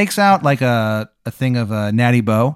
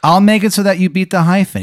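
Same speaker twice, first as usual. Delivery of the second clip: hammed-up bad Maryland accent, rising louder and higher; loud salesman with a terrible Maryland accent; Wizard of Baltimore; 7 semitones higher, 5 dB louder, faster than usual. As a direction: pitch the deal in an awful Maryland accent. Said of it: "worst Maryland accent you've ever heard".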